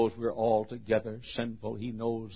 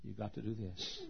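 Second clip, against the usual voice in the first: gentle God; 2 semitones lower, 18 dB quieter than usual. Acted. God coming down to explain, softly. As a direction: drop right down, quietly reasonable. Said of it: "God comes down and says".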